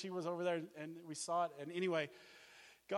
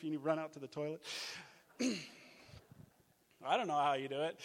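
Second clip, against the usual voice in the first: normal talking voice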